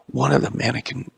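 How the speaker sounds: ordinary delivery, nothing marked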